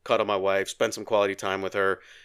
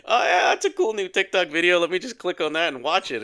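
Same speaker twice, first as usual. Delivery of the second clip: higher, louder burst of mock excitement; mock eager scroller; 9 semitones higher, 5 dB louder than usual. Man mimicking himself tempted by a TikTok video, mock eager. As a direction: act out being hooked by a shiny distraction.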